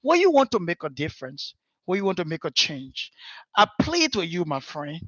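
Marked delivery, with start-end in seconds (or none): none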